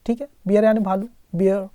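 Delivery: ordinary talk, nothing marked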